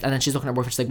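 usual speaking voice

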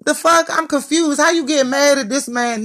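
high, disbelieving outburst; can't believe what she's hearing; incredulous. Real, not acted. Ranting at bitter women, high disbelieving outburst.

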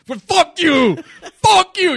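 high, loud imitated outburst; mock sudden explosion. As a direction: blow up like the enraged agent. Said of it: "Y el mae explotó". (imitando un estallido de furia a gritos)

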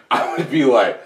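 ordinary delivery

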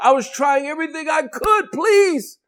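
retold plea, rising high; desperate pleading. Sinner trying everything to reach God, desperate pleading. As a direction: plead as if out of options.

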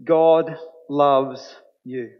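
"God loves you" drawn out very slowly, "God" stressed; deliberate, weighty proclamation; slow emphasis. Shown as a demonstration. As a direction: let each word land, unhurried. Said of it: "hear this".

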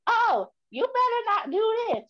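high, drawn-out mock scolding; scolding parent. Daughter mimicking parents warning her, high scolding voice. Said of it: "whose voice are you doing when you do that".

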